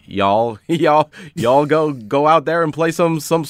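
lightly put-on Midwestern accent, goofy coach pep talk; a hint of Midwestern accent, rallying the team half in jest; mock Midwestern coach accent, breaking into laughs. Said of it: "the Midwestern English accent of".

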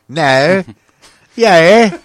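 loud, drawn Aussie vowels; hammed-up Australian one-word calls; mock Australian accent. Playing on the accent slip, mock Australian accent.

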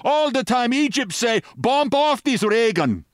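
high, excitable foreign henchman; high-pitched, broken-English outburst; mock shouting. A narrator's reading. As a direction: shout in a high voice without real volume. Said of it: "he shouted".

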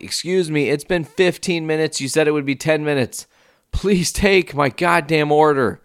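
acted exasperated customer; boiling impatience. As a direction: plead indignantly, slowing down for the final demand. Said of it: "I'm starting to boil".

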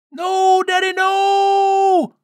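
very high, loud, long-held scream. A narrator's reading. Fire spirit as the mountain crashes onto him, screamed. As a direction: wail it out at full pitch. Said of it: "Peripilion screamed".

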